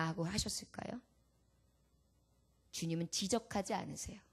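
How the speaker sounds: normal talking voice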